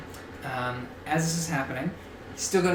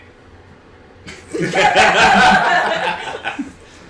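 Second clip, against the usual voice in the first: loud, high laughing fit; real laughter; 8 semitones higher, 13 dB louder than usual. Real, not acted. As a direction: burst out laughing, long and loud.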